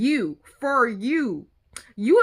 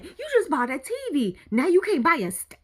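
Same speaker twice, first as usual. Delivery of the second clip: normal talking voice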